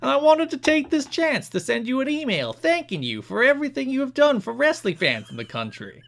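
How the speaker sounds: high, loud cartoon New Jersey accent, cracking into laughs. Reading a fan's letter to Jarrett in a New Jersey accent. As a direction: read the fan letter like Joey Wheeler. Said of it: "read this in a New Jersey accent".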